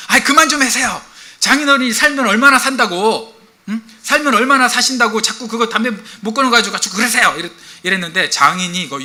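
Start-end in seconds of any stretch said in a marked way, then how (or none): 0.1-7.3 high, loud acted scolding; angry outburst. Son-in-law scolding his father-in-law over smoking, angry outburst. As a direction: blow up in exasperation, voice pitched right up. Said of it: "화를 버럭 내면서".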